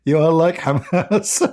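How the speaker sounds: slow words squeezed out through laughter; laughing. Real, not acted. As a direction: barely get it out while cracking up. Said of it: "laughing so hard I can't even breathe".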